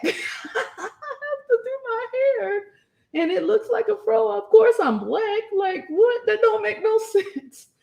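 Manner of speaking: high, laughing, slowing down; laughing protest. Real, not acted. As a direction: protest through laughter in disbelief.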